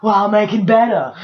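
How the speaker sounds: laughing, hammed-up seductress; mock seductive voice. Parodying the green heroine, laughing mock seductive line. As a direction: purr it through a laugh. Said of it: "And she's like".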